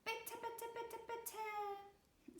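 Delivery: high, pleading little mouse voice; begging mouse child. A narrator's reading. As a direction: beg like a tiny mouse child. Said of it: "So bettelt die kleine Zipora". (hohe, bettelnde Mäusekinderstimme)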